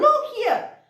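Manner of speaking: high, projected call. Shown as a demonstration. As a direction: wave people over with your voice. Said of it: "karena suara kamu". (panggilan lantang ke sini)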